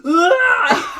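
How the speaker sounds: loud, high startled scream; mimicked high yelp of fright; imitated startled scream. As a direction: scream as if suddenly spotting someone, played for laughs. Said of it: "He, like, screams".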